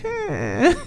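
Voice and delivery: a bored, barely-there grunt of "Eh."; mock grunt. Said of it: "she looked at me and went".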